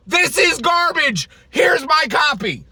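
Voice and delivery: high, slow, loud mock pan; mock scornful reviewer. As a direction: shout the fake pan in a high, slow sneer. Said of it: "Complete pan".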